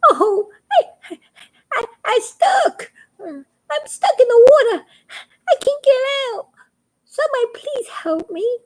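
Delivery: high, wailing frog cry for help. A narrator's reading. Little frog crying from the water for help. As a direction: sob it out high, stuck and scared. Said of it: "he cried".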